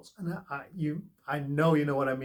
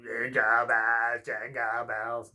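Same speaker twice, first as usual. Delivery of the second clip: scary voice; 3 semitones lower, 4 dB louder, slower than usual. Retold or imitated. Mocking the spooky voice listeners fear, hammed-up scary. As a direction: ham up a creepy ghost singing. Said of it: "some scary voice going".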